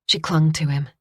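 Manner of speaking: ordinary delivery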